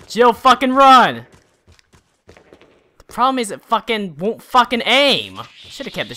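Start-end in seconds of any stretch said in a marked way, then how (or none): 0.1-1.2 slow, loud yell; urgent louder yell. Real, not acted. Player yelling at Jill to run, louder and slower. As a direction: drag the words out loud. Urging character to flee.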